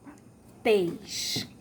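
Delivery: "Patês" with a closed final ê; "Patês" falling on a long, tight ê; closed vowel. Shown as a demonstration. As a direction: lean on the closed TÊS of "Patês". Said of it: "O som é fechado".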